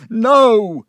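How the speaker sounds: loud, high, drawn-out NO; explosive agitated shout; shouted refusal. Acted. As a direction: bellow it in desperate protest. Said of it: "he shouted".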